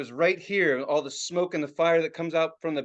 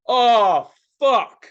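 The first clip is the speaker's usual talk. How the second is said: loud, high, drawn-out realization; dawning dread; 6 semitones higher, 10 dB louder than usual. Retold or imitated. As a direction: groan it loudly as disaster dawns. Reenacts realising masks were needed.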